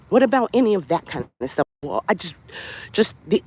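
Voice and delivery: usual speaking voice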